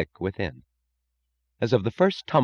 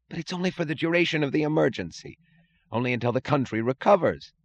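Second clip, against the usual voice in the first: higher, fast wheedling voice; pleading official; 8 semitones higher, faster than usual. A narrator's reading. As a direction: plead nervously, pitched up. Mooch pleading, high voice.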